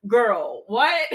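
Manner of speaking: punchy disbelief, pitch jumping up at the end; incredulous. Acted. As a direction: react like you can't believe what you were asked. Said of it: "give her a look like".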